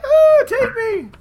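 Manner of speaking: very high, slow swooning falsetto. Pretend. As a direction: melt into a breathy swoon. Swooning role in story.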